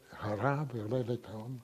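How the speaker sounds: normal talking voice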